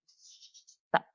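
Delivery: breathless whisper, cutting him off; hushed urgent stop. Retold or imitated. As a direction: hiss the word out to halt him. Halting a spirit mid-session.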